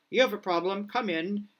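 loud, barking sergeant major. Acted. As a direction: order them in like a sergeant major. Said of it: "I'm using my Sergeant Major voice right now".

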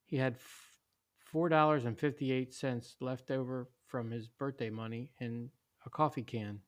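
ordinary delivery

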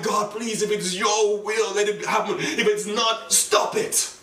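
high-pitched urgent pleading; scary, desperate prayer. Retold or imitated. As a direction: beg God, pushing to a forceful finish. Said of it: "it was a very scary prayer".

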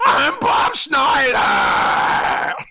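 huge, high, drawn-out yell of your own name; full-blast introduction, every word stretched; over-the-top panicked shout. Acted. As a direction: bellow it like a maniac at an audition. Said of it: "And I go like this".

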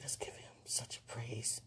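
hushed whisper; whispered quote. Retold or imitated. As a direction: whisper it reverently. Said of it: "God said".

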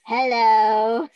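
loud drawn-out troll hello. Retold or imitated. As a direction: call it out like a cartoon troll. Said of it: "the trolls that go".

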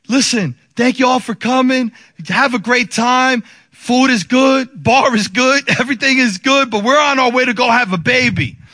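public announcement. Retold or imitated. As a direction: announce it over the party noise. Announcing departure to restaurant guests.

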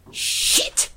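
forceful breathy curse; venomous curse. A narrator's reading. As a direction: spit the word out in shock. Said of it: "The venom exploded".

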